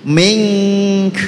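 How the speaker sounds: "Mink" drawn out on ng, ending in an airy k; correct stop on k. Shown as a demonstration. Teacher models how to stop on mingka, held "Mink". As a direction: hold "Mink" long, release only breath after k. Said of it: "hamesnya kaf tetap harus ada".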